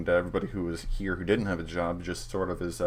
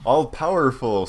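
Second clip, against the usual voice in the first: scoffing repeat of all-powerful; mocking echo; 5 semitones higher, 8 dB louder than usual. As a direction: sneer the word back at him. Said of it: "says Bapito".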